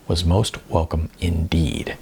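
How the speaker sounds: usual speaking voice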